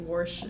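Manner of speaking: "warsh" said with an inserted r; the Kentucky "warsh" for wash. Retold or imitated. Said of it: "someone saying".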